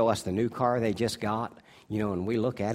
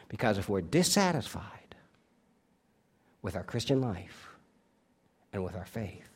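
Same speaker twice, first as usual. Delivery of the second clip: hushed warning; 5 semitones lower, 5 dB quieter than usual. Acted.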